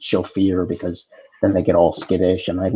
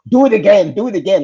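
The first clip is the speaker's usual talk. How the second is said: much higher and louder, begging; eager; 11 semitones higher, 7 dB louder than usual. Retold or imitated. Arnold begs Danny to repeat the prank, high loud and eager. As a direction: demand an encore like an excited kid.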